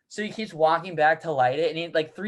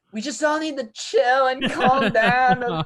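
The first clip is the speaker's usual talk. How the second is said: slow, high, spacey reassurance; blissed-out calm; 5 semitones higher, 5 dB louder, much slower than usual. Retold or imitated. Reed calming the kitchen, slow and spacey. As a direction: soothe the kitchen like you're floating. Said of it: "he's just just like".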